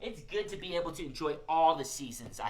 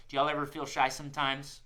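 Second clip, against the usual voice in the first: ordinary delivery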